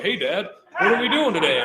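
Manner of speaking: happy dog voice. Retold or imitated. Trainer voicing his happy lab, mock eager. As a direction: voice the lab bouncing up for the day.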